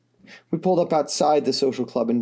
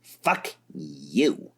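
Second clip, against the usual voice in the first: shot back; 10 semitones lower, 4 dB quieter than usual. A narrator's reading. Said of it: "Vriska shot back".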